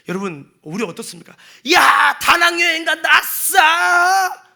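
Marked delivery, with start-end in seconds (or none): 1.7-4.4 loud, high whoop of delight; excited cheer. Retold or imitated. A churchgoer cheering about a Da Nang trip, in a loud excited whoop. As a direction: shout it like you just won. Mock joy over a trip.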